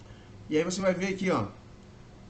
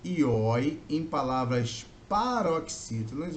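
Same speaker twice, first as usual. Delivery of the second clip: slow emphasis; slower than usual. Shown as a demonstration. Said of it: "teacher stressing the rule".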